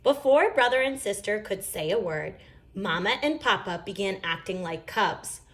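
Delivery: ordinary delivery, nothing marked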